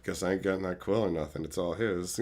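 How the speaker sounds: soft, low, fast resigned mutter; inner grumble. Retold or imitated. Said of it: "obviously internally".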